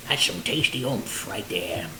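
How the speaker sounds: low, slow whispered pitch. Acted. Cook whispers his tasty oomph slogan to the camera, low and slow. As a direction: breathe it low like a secret.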